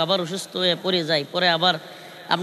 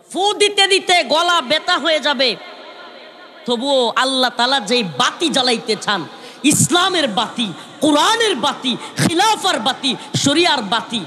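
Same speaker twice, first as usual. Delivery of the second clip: full-force waz preaching; fiery preaching; 4 semitones higher than usual. Acted. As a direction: drive the point home to the crowd.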